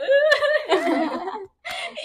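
high, loud imitated wail; long high-pitched fake bawl; mock crying. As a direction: burst into hammed-up sobbing. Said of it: "Yo lloré al final".

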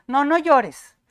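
loud, high mock admonishment; scolding don't-cry. Someone telling a crier not to cry, mock scolding. Voices people shushing a crier.

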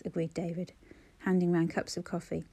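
usual speaking voice

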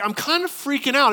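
hammed-up alarm; mock freaking out. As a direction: play Paul a little panicked. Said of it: "he's like".

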